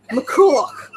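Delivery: mock-Scottish "McCulloch" with a laugh; hammed-up Scottish accent. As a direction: say "McCulloch" as a playful Scot. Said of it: "Must be very Scottish".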